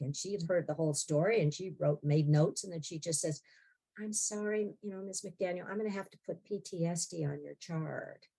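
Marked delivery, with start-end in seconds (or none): 4.0-8.3 softly voiced therapist breaking news; gentle regret. Therapist giving a patient a PTSD diagnosis, gentle regret.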